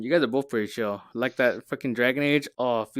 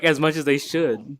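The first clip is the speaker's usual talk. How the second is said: ordinary talk, nothing marked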